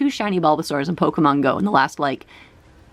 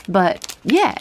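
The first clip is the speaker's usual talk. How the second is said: ordinary talk, nothing marked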